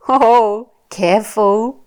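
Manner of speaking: animated, slow picture-book reading with big swoops; reading to a toddler. Shown as a demonstration. Mum sharing a picture book with her little one, animated child-directed reading. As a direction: draw out the words, playful and full of wonder.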